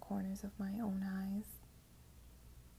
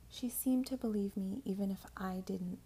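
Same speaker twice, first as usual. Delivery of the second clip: ordinary delivery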